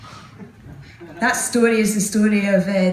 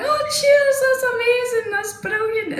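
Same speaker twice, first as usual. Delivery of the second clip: cheery lads; 13 semitones higher than usual. Retold or imitated. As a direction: squeak out thanks like a relieved young lad. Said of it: "all cheery".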